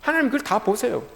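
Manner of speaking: ordinary talk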